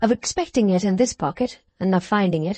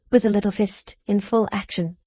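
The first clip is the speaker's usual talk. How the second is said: usual speaking voice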